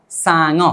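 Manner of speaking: सांग run straight through as one word, "sa-ngo"; low-pitched model सांग, syllables linked; joined pronunciation. Shown as a demonstration. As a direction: say सांग smoothly joined, ng gliding into the final vowel. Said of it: "लेकिन सीधा बोलेंगे".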